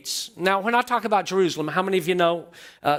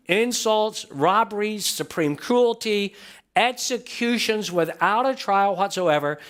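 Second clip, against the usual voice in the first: ordinary delivery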